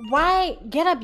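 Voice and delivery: a sudden loud high yelp of alarm; startled shout. Real, not acted. Streamer yells as the game jumps at him. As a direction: shout it out, caught off guard.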